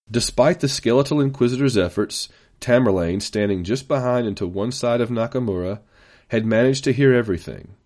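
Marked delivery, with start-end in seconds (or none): none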